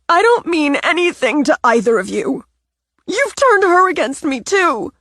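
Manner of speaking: high, loud, raw shout. Acted. Natalie shouting at Simone that she means nothing, high and loud. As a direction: scream it at her sister, voice cracking high. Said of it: "she shouted at Simone".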